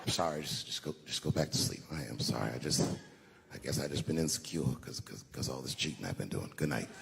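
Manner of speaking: low, soft late-night mumble; sheepish bedroom whisper; hushed apology. Acted. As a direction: murmur it so she won't wake. Man whispering apology to wife in bed.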